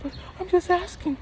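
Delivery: very high squeaky imitation of her pestering; high mocking girlfriend voice. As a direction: pitch way up as the nagging girlfriend. Mimicking girlfriend.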